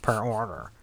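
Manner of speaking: soft, dismissive mock server; quiet put-on refusal, lightly mocking; picky server. Customer imitating wing places refusing two flavors, mock officious. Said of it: "Like some places".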